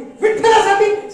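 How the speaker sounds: slow, high, loud shocked cry of विठ्ठलासाठी?; outraged shout. Retold or imitated. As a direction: bellow the word back in disbelief. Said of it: "आकांड Tandav".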